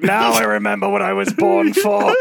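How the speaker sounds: slow, reverent revelation; enlightened seagull. Pretend. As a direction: say it like a destiny fulfilled. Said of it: "the seagull's like".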